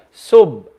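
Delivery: sub with lips shut on the b; no sound after the b of sub; without qalqala. Shown as a demonstration. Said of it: "No sound is coming out".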